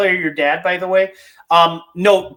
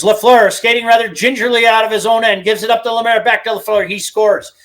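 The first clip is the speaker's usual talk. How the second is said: imitating the radio announcer's goal call, higher and louder; play-by-play call; 5 semitones higher, 3 dB louder than usual. Host recreating the old Lafleur goal call for his co-host, higher and louder play-by-play. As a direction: rattle it off like the broadcaster calling the goal.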